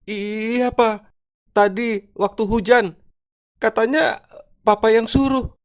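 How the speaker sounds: high, mock-tearful voice; plaintive high pitch, lightly acted weeping. Wife phoning husband at the office, mock tearful. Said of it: "Sambil menangis".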